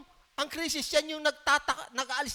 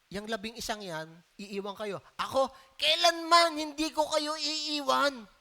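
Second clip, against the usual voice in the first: quoting Peter. Retold or imitated. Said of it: "Sabi ni Peter".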